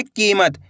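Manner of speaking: "कीमत" with the ई held long; खिंची हुई ई. Shown as a demonstration. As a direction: stretch the first vowel of "कीमत". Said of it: "इस तरीके से खींच के पढ़ना". ("कीमत" में ई को खींचकर)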